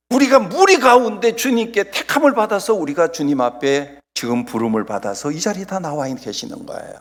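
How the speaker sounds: ordinary talk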